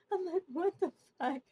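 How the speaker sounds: high, loud mock shock; giggly, incredulous outburst quoted from memory; retold disbelief. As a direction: squeal it as an amused retelling. Retells shocked reaction while giggling.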